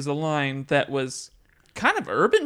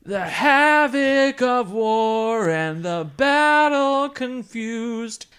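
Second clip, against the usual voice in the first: slow, high, louder mock-epic reading; dramatic recital; 7 semitones higher, 5 dB louder, much slower than usual. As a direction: ham up the lyric grandly. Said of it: "Deep, man".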